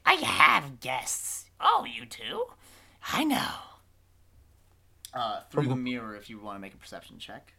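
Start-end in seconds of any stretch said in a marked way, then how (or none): 0.1-3.5 loud, high exclamations, slow at first; witch answering twin. Acted. Witch telling her mirror twin about guests, loud high retort. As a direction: holler back in a high witch voice. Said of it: "She's like".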